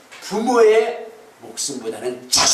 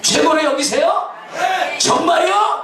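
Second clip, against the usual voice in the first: pressing challenge; 10 semitones higher, slower than usual. Real, not acted. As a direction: push them to answer honestly. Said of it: "preacher repeats it, pressing".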